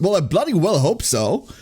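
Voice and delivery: usual speaking voice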